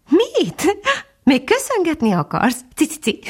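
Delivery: high, laughing little bird voice; cheerful titmouse. A narrator's reading. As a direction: chirp it merrily. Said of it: "nevetett a cinege jókedvűen".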